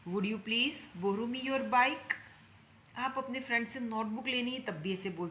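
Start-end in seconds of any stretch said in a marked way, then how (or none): 0.1-2.1 each word said very slowly and clearly; slow it right down for the learners; slow drill. Shown as a demonstration. Teacher repeating the sentence very slowly for students. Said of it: "एक बार और बोलती हूँ आपके लिए".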